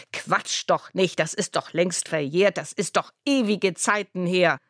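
ordinary delivery

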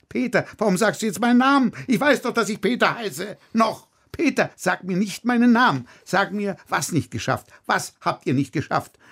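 high, biting repetition of her words; mocking fury. Director mocking his dramaturge's excuse, high and biting. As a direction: throw her words back at her.

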